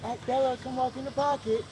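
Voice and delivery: high, soft sing-song call to a dog. Retold or imitated. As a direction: coax the dog into place, lightly. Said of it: "I'm like".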